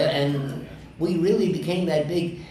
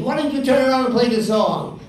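higher, louder retold challenge; quoting her; 8 semitones higher, 6 dB louder than usual. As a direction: toss it back like a dare. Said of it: "She said".

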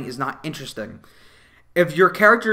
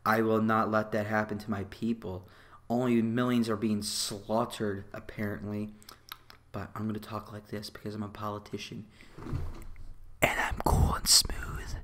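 a lowered soft parody of a calm princess, fading to a whisper; mock politician voice; 5 semitones lower, 9 dB quieter than usual. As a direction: imitate a smug politician talking low and smooth. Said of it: "and she talks like this".

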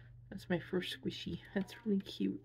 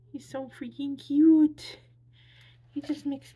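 loud, higher, slow squeal of delight; 7 semitones higher, 11 dB louder than usual. Real, not acted. Unboxing a gift from a friend, squealing with joy. Gushing over the plush keychain.

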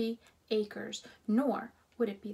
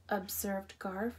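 ordinary delivery, nothing marked

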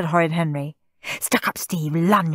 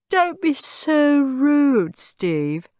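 yawning; 9 semitones higher, much slower than usual. A narrator's reading. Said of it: "said rich Aunt Ruby, yawning".

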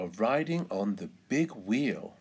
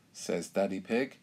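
ordinary delivery, nothing marked

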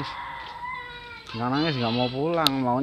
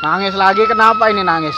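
loud, raised voice asking why; calling out; 8 semitones higher, 11 dB louder than usual. Real, not acted. Vlogger asking loudly why the child is crying again, raised call.

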